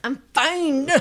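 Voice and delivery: high, strained "I'm fine" with a laugh; Friends quote. Retold or imitated. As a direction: push it out through a laugh. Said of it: "From Friends".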